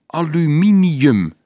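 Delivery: drawn-out, loud, high-pitched word; slow repeat. A narrator's reading. Dolf repeats the metal's name for Leonardo, slowly. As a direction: say it slowly and emphatically for someone learning it.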